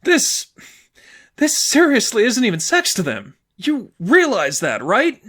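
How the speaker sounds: choked up. Acted. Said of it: "A choke and a clench almost in her throat".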